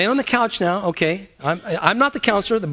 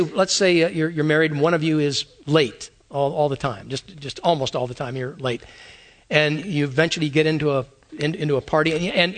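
ordinary delivery

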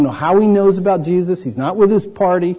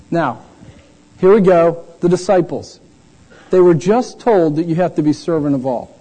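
ordinary talk

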